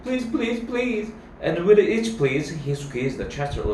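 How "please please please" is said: put-on high-pitched begging child; acted childlike pleading; pleading cry. A narrator's reading. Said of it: "cried Toby".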